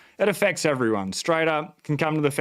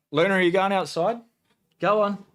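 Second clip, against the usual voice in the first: high sing-song call to the dog; 6 semitones higher than usual. Real, not acted. Owner coaxing the dog Luna outside, high sing-song call. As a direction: coax the dog out in a lifted voice.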